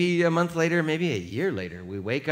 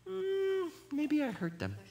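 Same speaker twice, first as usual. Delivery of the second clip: mild musing; 7 dB quieter, slower than usual. Pretend. Humble alternative thought.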